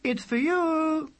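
high, slow call from afar. Acted. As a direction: call up the stairs. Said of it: "their mother called".

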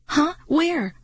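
startled and scared; cry of alarm. A narrator's reading. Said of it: "I cried out in alarm".

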